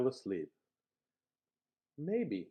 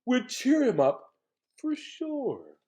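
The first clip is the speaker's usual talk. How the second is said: lion's voice; 9 semitones higher, 3 dB louder, slower than usual. Acted. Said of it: "he said".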